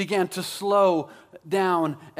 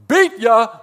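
much louder, higher taunt; gloating shout; 4 semitones higher, 12 dB louder than usual. Acted. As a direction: crow it like the race winner. Acts John gloating over the race.

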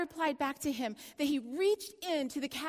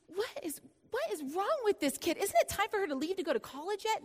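exasperated; 7 semitones higher, faster than usual. Retold or imitated. Retold exasperated thought.

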